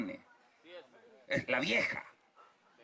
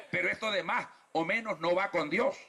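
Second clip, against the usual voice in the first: ordinary talk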